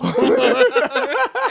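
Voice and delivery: a big, high burst of laughter; loud burst of laughter. Real, not acted. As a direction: crack up out loud. Laughs at the story.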